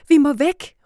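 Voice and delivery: loud, high cry to flee; urgent shout. A narrator's reading. Girl fleeing pretend flood, urgent shout. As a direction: yell it, urgent. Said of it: "råber Liv".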